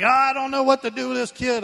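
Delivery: high, exasperated wail, sustained; mock flustered parent; imitated helpless parent. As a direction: throw up a helpless high-pitched whine. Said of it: "screaming and bawling, and they're like".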